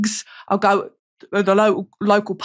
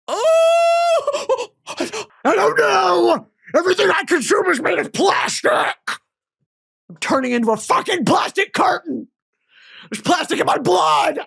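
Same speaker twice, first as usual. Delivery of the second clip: high, wailing mock hysteria; hammed-up panic; 8 semitones higher than usual. Ranting to viewers about plastic, in overacted panic. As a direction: freak out over plastic, overacted.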